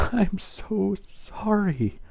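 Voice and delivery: slow, high, tearful; tearful apology. Acted. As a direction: draw it out in sorrow. Twilight hugging Luna, emotional.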